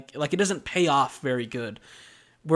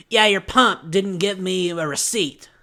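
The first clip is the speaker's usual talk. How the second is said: mock hostile complaint, pitched up and louder; hostile customer; 4 semitones higher, 5 dB louder than usual. Rude customer complaining to the gas station clerk, hostile. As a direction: imitate a customer who attacks from the start. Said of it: "She is hostile with me".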